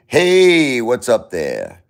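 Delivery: big, drawn-out hey, high and loud; loud Brooklyn Italian hello; Italian-American greeting. Shown as a demonstration. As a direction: open with a high hollered HEY, then drop. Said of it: "So you see it Italian".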